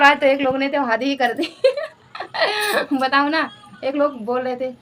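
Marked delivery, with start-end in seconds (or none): 2.1-2.9 a high burst of real laughter. Real, not acted. Housewife laughing to viewers about a cheeky fan. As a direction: laugh out loud, amused.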